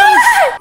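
high loud shriek; startled scream. Real, not acted. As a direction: shriek in fright. Monster passes, fright.

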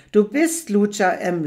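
the same words now yelled in sudden rage; sudden angry shout. Acted. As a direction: snap into fury on Lucia M. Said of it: "schreit er mit plötzlicher Wut".

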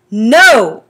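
loud, firm refusal. A narrator's reading. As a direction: shut it down firmly. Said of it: "I said firmly".

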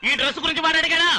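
high-pitched indignant question; exasperated scolding. Acted. Groom scolding friend about the dress, exasperated. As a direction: challenge him, pitch climbing in irritation.

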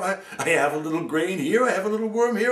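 high, loud sparrow voice; chirpy sparrow. Acted. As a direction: boast it brightly to the crow.

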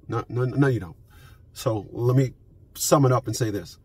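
ordinary talk

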